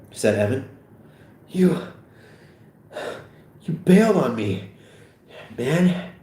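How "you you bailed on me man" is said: winded, higher accusation; out of breath, words in bursts; wheezing. Acted. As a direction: accuse him between gulps of air. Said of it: "Seth, wheezing heavily".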